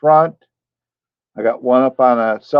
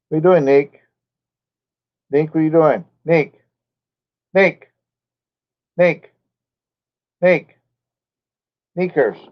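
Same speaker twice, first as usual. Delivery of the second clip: higher-pitched, checking-on-the-dog voice; real, raised-pitch call to a dog; 4 semitones higher than usual. Real, not acted. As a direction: call out the pet's name to get its attention. Calling to the dog by name.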